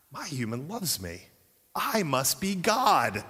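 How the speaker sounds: a hint of a self-important cat; smug cat. The cat reasoning about its human, lightly smug. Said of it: "cat theology goes like this".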